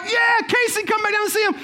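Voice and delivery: very high celebratory holler; excited calling out. Retold or imitated. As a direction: cheer and call the kid back, retold. Retold group cheer calling daughter.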